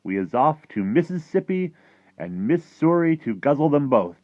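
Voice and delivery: high, loud mock yelling; hollered boast in a giant's voice; giants' yell. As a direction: imitate giants yelling as they pass. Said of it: "they was yelling".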